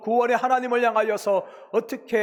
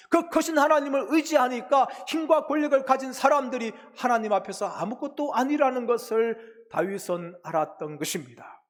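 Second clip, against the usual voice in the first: normal talking voice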